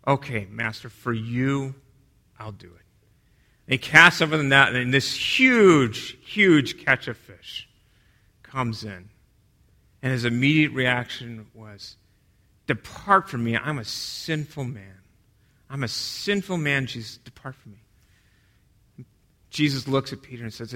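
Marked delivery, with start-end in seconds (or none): 0.1-2.8 weary sigh of a spent fisherman, much softer; a worn-out Peter giving in quietly; exhausted. Acted. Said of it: "and he's exhausted". 12.7-19.0 much softer, fading to a breathless plea; ashamed plea. Acted. Peter overwhelmed before Jesus.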